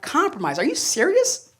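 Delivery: put-on replying to girlfriend's compromise, incredulous high scoff. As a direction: pitch leaps up on "serious".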